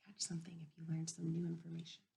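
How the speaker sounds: fast unvoiced whisper; whispered reminder. Real, not acted. Teacher whispering a quick reminder to the class. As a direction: slip the reminder in under your breath.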